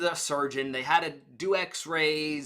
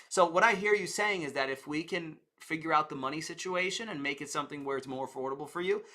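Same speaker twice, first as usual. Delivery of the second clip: ordinary delivery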